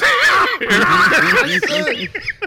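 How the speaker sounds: long high laugh rising. Real, not acted. Cohost laughing at the new segment idea, high laughter. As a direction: let a big laugh rise up.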